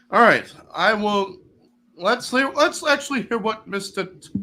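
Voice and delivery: ordinary talk, nothing marked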